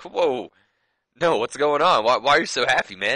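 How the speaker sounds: mock-eager questions; excited whoa. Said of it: "he was just like".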